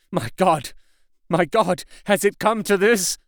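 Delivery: high, alarmed volley of questions; crying out. A narrator's reading. Husband seeing blood on his wife, crying out in alarm. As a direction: cry out in mounting bewilderment. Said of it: "Harker cried out".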